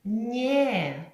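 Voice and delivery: нє: soft н plus й-glide. Shown as a demonstration. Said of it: "а м'яко ми скажемо".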